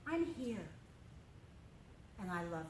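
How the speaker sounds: soft, gentle reassurance; Jesus softly reassuring doubting Thomas; tender. Retold or imitated. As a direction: speak softly, as if comforting a friend. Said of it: "Jesus walked up to Thomas and said".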